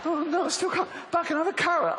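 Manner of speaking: very high, whining dismay; hammed-up famished whimper; mock despair. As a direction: moan in comic defeat. Acts starving person still stuck.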